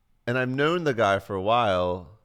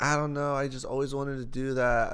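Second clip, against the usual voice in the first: higher-pitched mock-girl excuse; imitated girl; 5 semitones higher than usual. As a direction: voice her explanation, pitched up. Voices girl explaining herself.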